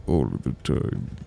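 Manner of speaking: slow, very low rumble; deep voice. Shown as a demonstration. Repeating the wish in the deep voice. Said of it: "sounds so drastically different".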